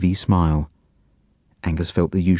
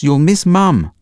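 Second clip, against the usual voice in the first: calling out; 8 semitones higher, 5 dB louder, slower than usual. A narrator's reading. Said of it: "called Angus".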